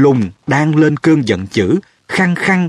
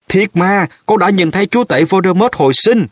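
mock yell; 8 semitones higher than usual. A narrator's reading. Harry insisting he saw Voldemort return, mild high yell. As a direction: cry out insistently, pitched up without full volume. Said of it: "Harry thét lên".

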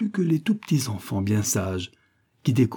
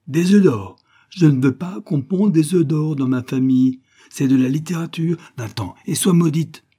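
loud, high furious rant; angry rooster; 5 semitones higher, 6 dB louder than usual. A narrator's reading. Rooster father cursing his daughter, loud and furious. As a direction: curse her out at full volume. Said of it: "il était en colère".

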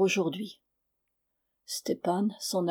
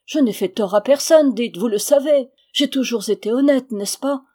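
loud, higher, urgent appeal; pleading; 4 semitones higher, 8 dB louder than usual. A narrator's reading. Husband begs elders to vouch for him, pleading. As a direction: implore them with a raised voice. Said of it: "dit-il d'une voix suppliante".

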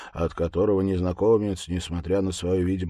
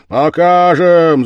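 loud, drawn-out cheer; crowd shout; 10 semitones higher, 15 dB louder, slower than usual. Acted. The lads roar their answer, loud. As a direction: roar it back together. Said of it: "закричали парубки".

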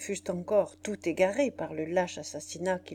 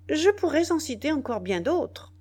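proud boast, pitched high and louder; 8 semitones higher, 5 dB louder than usual. A narrator's reading. Soldier boasting to his general of his deeds, proudly. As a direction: boast of your record with raised voice. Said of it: "repartit le nègre avec orgueil".

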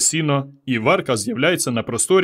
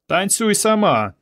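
high, drawn-out teasing; mocking taunt; 8 semitones higher, slower than usual. A narrator's reading. Village boys goad Varka to dance, teasing. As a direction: egg them on with a slow taunt. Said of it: "сміялися хлопці, під'южуючи Варку".

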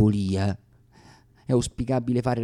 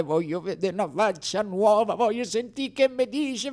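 higher-pitched pleading into the phone; desperate phone call; 8 semitones higher than usual. Retold or imitated. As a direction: call a friend in mock despair. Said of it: "io chiamai disperato un mio amico".

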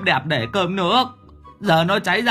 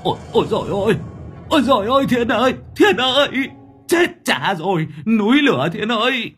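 high, loud frantic cries of alarm; panicked wailing; 12 semitones higher, 4 dB louder than usual. Acted. Thưởng Báo panics as his kitchen catches fire, wailing loud. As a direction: wail in terror at the fire.

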